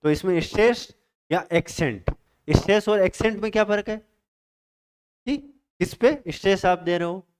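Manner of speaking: ordinary talk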